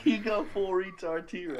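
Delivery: laughing through the line; giggling while teasing; teasing a friend, laughing through it. Real, not acted. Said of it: "you look like this".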